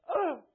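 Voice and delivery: short, high, loud yelp of fright; startled gasp. Retold or imitated. Preacher reliving his brother's scare, startled high yelp. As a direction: jump out of your skin. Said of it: "And, and I was just like".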